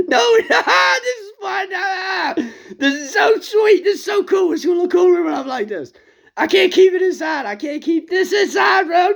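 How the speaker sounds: bursting with glee, can't hold it in; gleeful shout. Acted. Elijah thrilled before the fire falls, shouting high.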